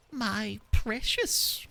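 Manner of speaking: Gollum voice. Retold or imitated. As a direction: stretch it out like Gollum cradling his treasure. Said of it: "I can't do a Gollum voice".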